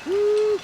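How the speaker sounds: high loud falsetto woo-hoo; woo-hoo cheer. Real, not acted. Cheering out window.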